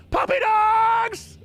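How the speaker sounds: high, slow hammed-up wail; slow high mock lament. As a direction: cry out for the puppies, melodramatic. Mock wailing over lost puppies.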